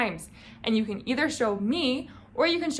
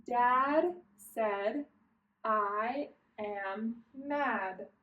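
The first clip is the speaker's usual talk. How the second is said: word by word with clear gaps; deliberate, slow read-along; slow careful reading; 4 dB quieter, much slower than usual. Shown as a demonstration. Teacher reads one more time for learners, slow word by word. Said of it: "One more time".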